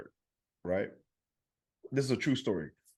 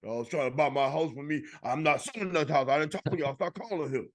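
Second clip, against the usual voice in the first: put-on higher, indignant old woman; fed-up old lady voice; 4 semitones higher than usual. Comedian voices an old lady scolding scam callers. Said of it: "And I go".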